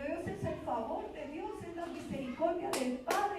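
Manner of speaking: high, slow, stretched declaration of praise; fervent proclamation. Retold or imitated. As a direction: proclaim it with rising fervour. Proclaims God's favour to her husband. (proclamación fervorosa y pausada)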